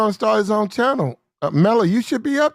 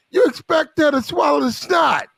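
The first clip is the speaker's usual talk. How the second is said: raised, higher ranting voice; shouting; 6 semitones higher than usual. Real, not acted. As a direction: call it out in a heated rant. Heated rant about American women.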